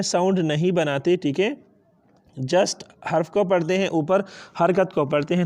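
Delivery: normal talking voice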